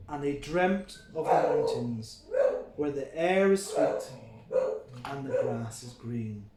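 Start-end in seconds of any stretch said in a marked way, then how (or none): none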